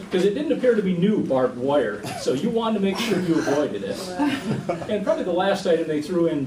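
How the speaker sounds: chuckling through the words; amused, laughing while telling it. Real, not acted. Joking about old barbed wire.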